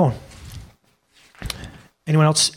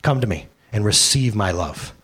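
gentle Jesus; 7 semitones lower than usual. Acted. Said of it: "He said".

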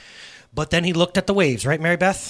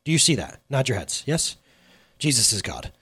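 ordinary delivery

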